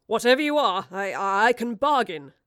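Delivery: loud, stammered bargaining; spluttering. Acted. Marlowe pleads with the creature holding him, spluttering. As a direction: splutter it out, stumbling on the words. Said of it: "spluttered Marlowe".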